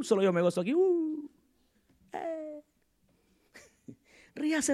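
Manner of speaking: ordinary talk, nothing marked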